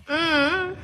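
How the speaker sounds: ordinary talk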